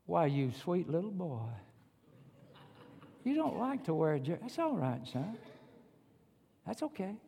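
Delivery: coddle him softly in pretend sympathy; mock sweet coach. Coach pretending to coddle a player without a jersey, mock sweet. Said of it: "Here's what Coach Saban or your Georgia coach would have said".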